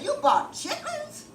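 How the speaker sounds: high, slow, disbelieving squeak; incredulous. Retold or imitated. Man scoffing at her chitlins, high and incredulous. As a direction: act out his shocked disbelief.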